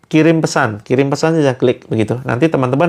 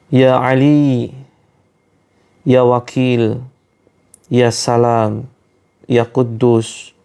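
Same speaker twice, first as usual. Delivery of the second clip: slow, measured prayer leader's chant; devout prayer recitation; 2 semitones lower than usual. Acted. Healer leading online listeners in ruqyah prayer, slow devout recitation. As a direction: recite each name of God slowly and earnestly.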